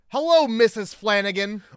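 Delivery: hammed-up call greeting, sung out loud; mock phone greeting. Podcaster imitating the handyman answering mother-in-law's call, hammed-up greeting.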